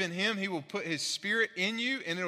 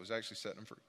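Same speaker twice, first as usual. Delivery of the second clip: normal talking voice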